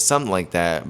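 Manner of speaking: ordinary delivery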